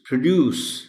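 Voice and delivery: "پروڈیوس" as pruh-DUCE, pitched high; correct pronunciation. Shown as a demonstration. Teacher repeating the model for pupils, "پروڈیوس" as pruh-DUCE. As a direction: say "پروڈیوس" with a short uh in the first syllable. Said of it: "اے sound میں".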